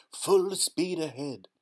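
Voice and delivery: loud, high and slow; rally the crew with a big call; excited shout. A narrator's reading. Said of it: "shouted Mister Wonka".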